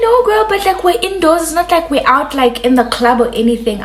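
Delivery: high, pleading reassurance; coaxing friend. Retold or imitated. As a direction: talk her into it, sweetly.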